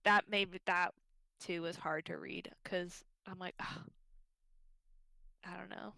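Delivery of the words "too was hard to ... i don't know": whisper. Real, not acted. Soft aside about painful scene.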